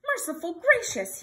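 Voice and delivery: loud, high exclamation of shock; shocked old-fashioned oath, voice leaping up; aghast gulp. A narrator's reading. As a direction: blurt it out in startled dismay. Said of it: "he gulped".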